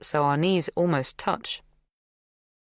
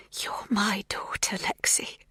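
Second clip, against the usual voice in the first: slow tender whisper; 5 semitones higher, 4 dB quieter, slower than usual. A narrator's reading. Magda whispering to her daughter Lexi. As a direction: breathe it slowly and tenderly.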